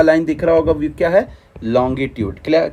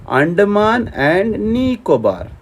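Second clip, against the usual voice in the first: slow, drawn-out "Andaman and Nicobar", pitched a little up; slow emphatic repeat; 3 semitones higher, much slower than usual. Shown as a demonstration. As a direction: stretch out each name for the class. Repeats the name slowly for students. (धीरे-धीरे, खींचकर ज़ोर देते हुए)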